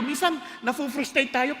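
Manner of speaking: ordinary delivery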